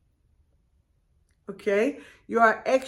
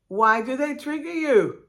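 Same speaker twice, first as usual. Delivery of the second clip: ordinary talk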